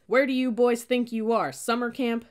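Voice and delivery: bellowing. A narrator's reading. Cadet to boys lounging in the bleachers, bellowing. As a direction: bellow it at slouching recruits. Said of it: "bellowed a deep voice".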